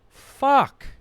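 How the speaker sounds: loud, high-pitched burst of dismay; shocked realization. Acted. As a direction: curse as the awful truth hits you. Re-enacts his shocked epiphany.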